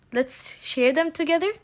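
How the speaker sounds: normal talking voice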